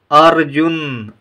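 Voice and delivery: Arjun drawn out slowly, r clear; slow model reading. Shown as a demonstration. Teacher reads Arjun slowly for the class to repeat. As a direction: stretch Arjun for repetition. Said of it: "आइए पहले हम इन शब्दों को पढ़ें".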